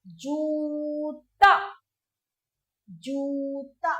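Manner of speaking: जूता said very slowly with a long ऊ; जूता, slow, long ऊ held; long oo in joota. Shown as a demonstration. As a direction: stretch the ऊ in जूता for the children. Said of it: "जिसमें सबसे पहले कौन सा है".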